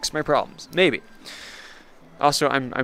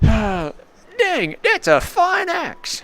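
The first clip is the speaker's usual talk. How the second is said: much higher, very slow, put-on voice; drawling NPC admiration; 4 dB louder, much slower than usual. NPC admiring the player's new axe, high and slow. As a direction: stretch it out like an impressed old timer.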